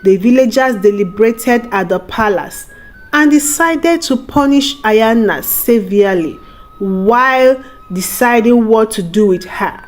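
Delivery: plain reading voice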